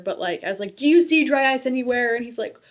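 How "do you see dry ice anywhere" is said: loud, mock-incredulous retort; sarcastic jab at husband about dry ice. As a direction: throw the question back at him sarcastically. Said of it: "still being sarcastic".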